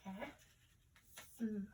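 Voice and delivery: "A, mm" whispered, each sound apart. Shown as a demonstration. Teacher whispering the separate sounds of am. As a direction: whisper the two sounds slowly. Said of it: "There are two sounds in".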